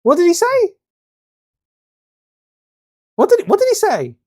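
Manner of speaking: high, loud incredulous huh; can't believe what he just heard; disbelief. Real, not acted. Relistening to the dub line, high disbelief.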